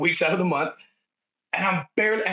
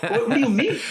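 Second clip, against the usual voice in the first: laughing disbelief; 7 semitones higher, 4 dB louder than usual. Retold or imitated.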